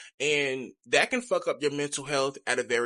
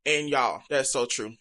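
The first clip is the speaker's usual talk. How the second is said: ordinary delivery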